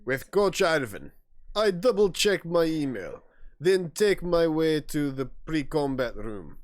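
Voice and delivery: slow, halting Russian accent attempt; amateur Russian accent. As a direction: try a shaky-footed Russian accent, drawn out. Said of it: "I'm not good at Russian accents".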